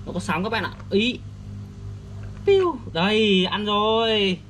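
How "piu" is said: high squeaky whoosh for the cast; mouth sound effect. Acted. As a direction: zip the line out with a high "chiu". Toy fishing, casting sound. (tiếng vút cao)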